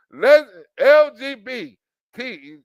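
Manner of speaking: slow, each letter apart; spelled out slowly. Shown as a demonstration. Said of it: "wasn't that first?".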